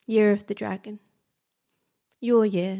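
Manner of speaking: usual speaking voice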